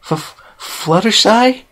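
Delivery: high, slow, stammered squeak; a tiny high squeak of disbelief; barely a squeak. Acted. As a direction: squeak out her name in disbelief. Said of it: "her voice barely a squeak".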